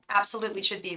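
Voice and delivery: usual speaking voice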